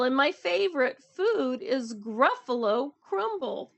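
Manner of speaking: emphatic, rhythmic storytime reading; picture book read-aloud. A narrator's reading. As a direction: build the tale for children with lightly acted lines. Reads story with character voices.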